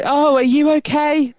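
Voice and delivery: a hint of a bubbly chatty cabbie; chatty driver.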